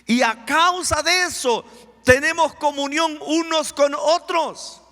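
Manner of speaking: high, loud proclamation; full-force preaching. Acted. Preacher expounding the verse to congregation, loud fervent preaching.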